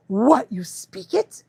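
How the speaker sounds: slow, laughing mock disbelief; astonished husky. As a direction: voice a stunned dog's reaction. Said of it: "he looks at me like".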